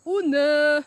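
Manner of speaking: sudden loud oh no; startled shout. Real, not acted. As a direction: cry out in alarm. Sudden discovery while walking.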